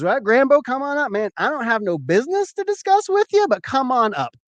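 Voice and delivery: high imitation of a buddy calling out; hearty invitation. Scotty inviting Grambo up to the show, hearty invitation.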